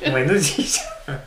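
fast, laughing through the words; can't stop laughing, tears coming; crying with laughter. Real, not acted. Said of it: "笑いすぎて泣いてるんですけど". (泣き笑い)

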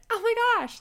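high, sweet retold voice; sugary high exclamation; totally nice. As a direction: switch to polite niceness. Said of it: "like, totally nice".

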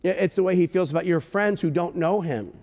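usual speaking voice

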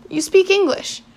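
surprised. Acted. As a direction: blurt it out in surprise. Peter surprised at English.

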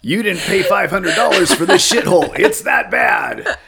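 hammed-up yelling dad; mock outraged shout. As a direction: rant at the kid in played-up outrage. Acted rant at the kid.

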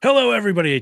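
bright, loud hello to everyone; loud greeting. Real, not acted. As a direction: boom out the greeting.

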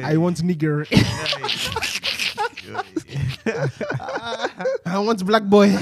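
laughing while playing the policewoman; mock woman's voice. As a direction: act out the girl's wish with a laugh.

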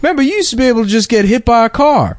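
put-on high, reminiscing old-timer; mock nostalgic old man. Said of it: "He's like".

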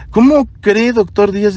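a hint of a nervous young woman protesting; flustered nurse. Flustered nurse denying discomfort.

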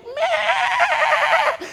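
high, loud mock bleating of a lamb. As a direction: bleat like a lamb being slaughtered. (malakas na pag-ungol ng tupa)

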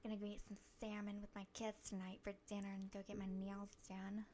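soft, quick celebrity character voice; Jennifer Coolidge as Fiona. Shown as a demonstration. Host performing Fiona on request, soft character voice. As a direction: murmur it as the movie diva character. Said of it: "Give us some Fiona".

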